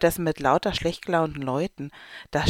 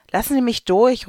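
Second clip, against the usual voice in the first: louder, higher call to get through; raised pushy call; 7 semitones higher, 7 dB louder than usual. Acted. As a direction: push through the crowd with your voice. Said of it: "ruft die Frau".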